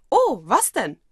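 bright burst of interest; suddenly interested. Acted. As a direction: perk up with curiosity. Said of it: "sofort interessiert".

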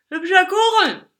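put-on loud, slurred drunkard; slurring drunk. A narrator's reading. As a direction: slur it loudly like a drunk. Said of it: "lallte der Betrunkene".

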